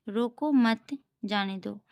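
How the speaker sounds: रोको मत as one group, then जाने दो; pause after मत. Shown as a demonstration. Teacher shows where comma falls, pausing after मत. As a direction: break between मत and जाने दो. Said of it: "यहाँ रोको मत के बाद कॉमा है".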